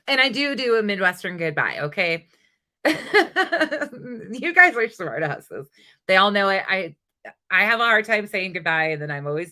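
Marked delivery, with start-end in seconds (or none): 2.8-5.7 slow laughing jab. Real, not acted. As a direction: laugh it out at the chat. Laughing at chat.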